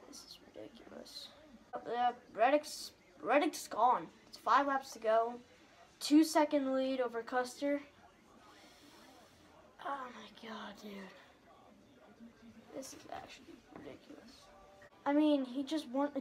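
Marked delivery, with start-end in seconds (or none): 0.1-1.3 sighing whisper; frustrated whisper. Real, not acted. Frustrated as race slips. 9.8-14.3 sighing whisper; frustrated whisper. Real, not acted. Frustrated as race slips.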